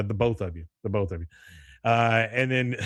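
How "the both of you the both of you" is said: low, understated mock order; imitated stern dismissal. Class leader sending two boys out, imitated stern dismissal. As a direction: say it low, as a retold command.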